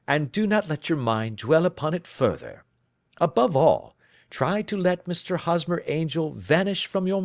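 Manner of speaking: firm, kindly and commanding; reassuring detective. Acted. Holmes to his client, firm and reassuring. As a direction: take the weight off her shoulders.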